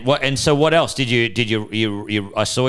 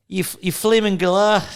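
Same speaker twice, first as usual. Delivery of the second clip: put-on high-pitched go at a Kiwi accent; attempted New Zealand accent; 9 semitones higher than usual. Co-host failing at a New Zealand accent, hammed-up attempt. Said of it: "trying to do a fucking New Zealand accent".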